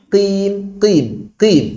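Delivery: usual speaking voice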